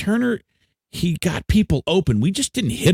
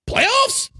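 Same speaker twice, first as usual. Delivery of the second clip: incredulous. Real, not acted. Disbelief at skipping playoffs.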